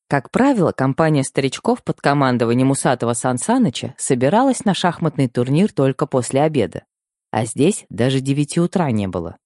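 ordinary delivery, nothing marked

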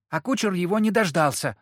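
put-on high, loudish child's voice; girl butting in. A narrator's reading. Girl butts in about the coachman, high and eager. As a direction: cut in like an eager little girl. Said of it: "влезла девочка".

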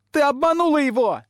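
very high, loud squeal; piercing girl's screech; shrieking. A narrator's reading. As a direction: shriek the accusation. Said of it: "визжит пламя".

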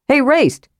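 high-pitched hail; calling out. A narrator's reading. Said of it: "Karaman called".

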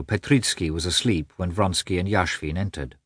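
usual speaking voice

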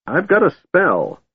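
a hint of a fussy old mage; protesting old wizard. A narrator's reading. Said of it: "Fizban protested".

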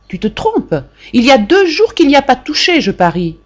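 mother scolding her son about the pot. A narrator's reading. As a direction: scold the boy for the dirty pot. Mother scolds son over chores.

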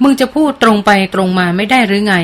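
ordinary delivery, nothing marked

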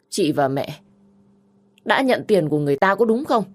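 hurt, accusing challenge; accusing younger sister. Acted. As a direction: confront her about the money.

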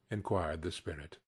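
ordinary delivery, nothing marked

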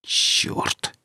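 barely audible whispered curse; soft, venomous whisper; angry hiss. A narrator's reading. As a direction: hiss it under the breath, voiceless and bitter. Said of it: "едва слышно со злостью прошипел".

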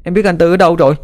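put-on high, insistent child's voice; high and resolute; determined child. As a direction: urge in a high young voice. Sick child insists on going out.

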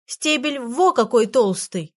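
медленно тянет слова, как показывая размер; растяни восклицание, изображая огромное; медленно, с нажимом. Acted. Фенечка показывает размер розы. (медленно и с нажимом)

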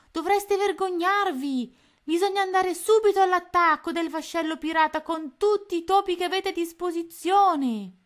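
high, loud furious yell; furious shout. A narrator's reading. Tony scolding the useless mice, furious shout. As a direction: bellow it at the useless troops. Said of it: "Tony era infuriato".

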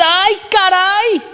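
high, slow drawn-out gasp of dismay; mock dismay. Preacher voices the listener's shocked reaction, high and slow. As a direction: stretch out a shocked sigh.

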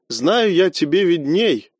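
ordinary delivery, nothing marked

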